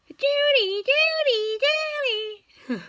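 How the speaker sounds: high chirping "Judy" call; high mimicked birdsong; bird call imitation. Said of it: "sounds like it's going".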